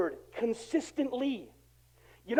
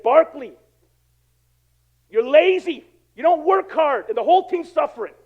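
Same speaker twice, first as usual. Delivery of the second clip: loud, raised retold dressing-down; stern scolding; 3 semitones higher, 7 dB louder than usual. Preacher voicing a veteran scolding his lazy teammate, stern and loud. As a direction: deliver a hard, loud reprimand. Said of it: "Moses Malone came up to him".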